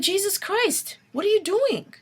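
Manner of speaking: high, exasperated cry at allies; exasperated outburst. Real, not acted. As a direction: throw up your hands in disbelief.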